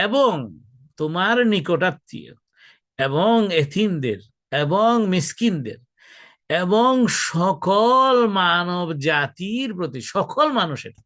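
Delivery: loud, high, slow list with stretched words; emphatic preaching. Acted. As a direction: proclaim each group forcefully, drawing it out. Preaches translation of verse emphatically.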